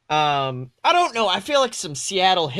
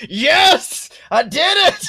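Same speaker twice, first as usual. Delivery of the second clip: high, loud victorious yell; triumphant cheer; 10 semitones higher, 8 dB louder, slower than usual. Retold or imitated. Friend retelling her Slytherin result, cheering loud. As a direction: cheer like you just won. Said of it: "I was like".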